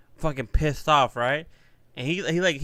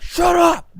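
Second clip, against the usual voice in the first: furious dad yelling; 11 dB louder than usual. Retold or imitated. Angry dad beating his kid.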